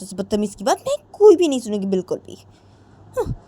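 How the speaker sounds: fuming to herself. Acted. Radhika fuming alone.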